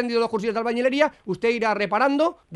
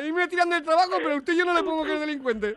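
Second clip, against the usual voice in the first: higher, hammed-up weepy voice; fake tearful; 5 semitones higher than usual. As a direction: melodramatic teary self-sacrifice. Prankster hams tearful sacrifice. (sollozo de broma)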